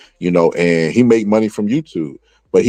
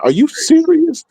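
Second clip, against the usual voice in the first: much higher, slowed outraged disbelief; angry disbelief. Retold or imitated. Retelling his reaction to the family's seminar fee, high outraged disbelief. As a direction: stretch out are you serious in shocked outrage. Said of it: "when I tell you I was angry".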